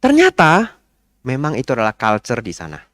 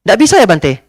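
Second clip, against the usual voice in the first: high, loud irritated question; imitating the annoyed lady; 8 semitones higher, 6 dB louder than usual. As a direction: sound put out that he refuses. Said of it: "Ibunya marah".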